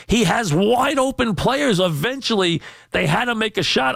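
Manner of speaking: arguing hard against the critics; rising indignant rant; heated rant. Real, not acted. Ranting defence of LeBron.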